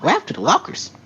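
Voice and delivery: high-pitched, cheerful but scared question; cheerful yet scared. Acted. Reacting to the wrecked locker room, cheerful yet scared. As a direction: put on a cheery, high voice with fright in it. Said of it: "in a cheerful yet scared tone".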